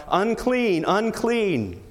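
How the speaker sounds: high, falling call of unclean; leper's cry. Acted. Leper warning people away from him, high falling cry. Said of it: "cry out, 'Unclean, unclean.'".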